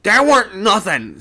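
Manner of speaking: a crewman contradicting the others; sailor's voice. A narrator's reading. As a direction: put on a common seaman. Sailor in the crowd objecting.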